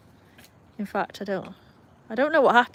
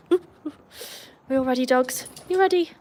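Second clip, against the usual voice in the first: excited dog talk; 4 semitones higher than usual. Real, not acted. Calling her dogs to come inside.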